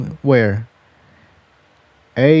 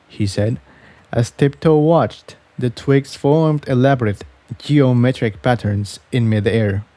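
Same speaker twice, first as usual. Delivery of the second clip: usual speaking voice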